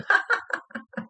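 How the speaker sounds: high cackling bird-like laugh; mimicked high ha-ha-ha; imitated laughing. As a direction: laugh high and silly at someone. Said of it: "It's her laughing at you".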